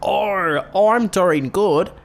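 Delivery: high, loud mock Aussie reply; over-the-top imitated Australian, loud and high; exaggerated Australian accent. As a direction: ham up the Australian vowels. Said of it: "the way that Australian people sound".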